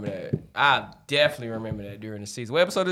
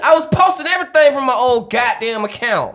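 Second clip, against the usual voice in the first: loud sharp shout; yelling; 11 semitones higher, 12 dB louder than usual. Real, not acted. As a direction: yell it out hard. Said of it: "Was we yelling at him?".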